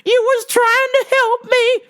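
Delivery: hammed-up high sobbing-sorry lady voice; mock apologetic customer. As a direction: gush sorry like a guilty customer. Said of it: "She's like".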